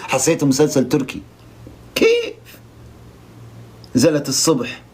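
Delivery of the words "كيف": mock Lebanese "كيف؟" squeaked far higher. Vlogger mocks a Lebanese contestant, squeaky Lebanese. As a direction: parody a Lebanese girl asking why, pitch shooting up. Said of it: "فاحنا نتكلم لبناني مثلها".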